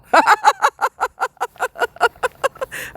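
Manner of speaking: real laugh breaking out high; high burst of laughter. Real, not acted. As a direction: crack up at your own words. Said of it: "I can't even say that with a straight face".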